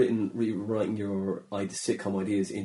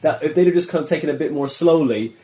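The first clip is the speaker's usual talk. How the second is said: louder, higher, eager; excited; 6 semitones higher, 10 dB louder than usual. Real, not acted. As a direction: push it out with enthusiasm.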